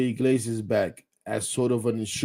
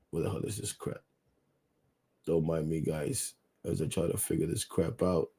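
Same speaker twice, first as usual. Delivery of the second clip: low, very soft grumble; half under the breath, much softer and lower; muttering to oneself; 6 semitones lower, 11 dB quieter than usual. Real, not acted. Host hunting for figures on air, muttering. Searching for figures while live.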